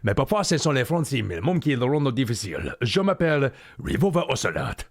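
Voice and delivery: mock-French phone call; improvised French with a put-on French accent; Ocelot speaking French. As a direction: play Ocelot schmoozing in French. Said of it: "call someone else and be like".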